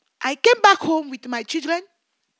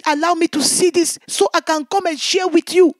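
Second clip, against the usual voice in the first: ordinary talk, nothing marked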